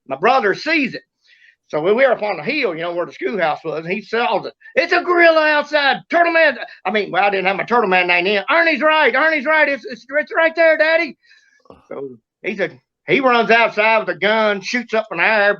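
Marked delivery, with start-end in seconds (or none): 4.8-6.6 high-pitched alarm call; retold excited call. Brother alerting the boy outside, acted excited call. 8.5-11.2 put-on high-pitched child's urgency; retold urgent alarm. Boy backing his brother to Daddy, acted high urgency.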